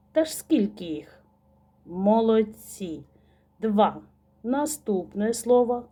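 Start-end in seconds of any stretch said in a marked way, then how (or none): none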